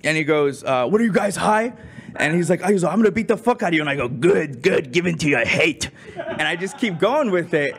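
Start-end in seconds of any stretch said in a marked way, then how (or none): 0.9-3.8 high-pitched mock angry threats; imitated flipping out. Man threatening the prank callers, mock flipping out. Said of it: "he starts flipping out". 4.1-5.9 sinister, croaking villain impression; the Emperor. Drunk prankster egging on angry man, villain impression. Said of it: "I start imitating the Emperor from Star Wars".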